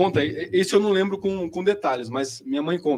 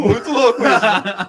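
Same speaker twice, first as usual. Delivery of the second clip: laughing; 4 semitones higher, 7 dB louder, slower than usual. Real, not acted. Laughing at own late speech.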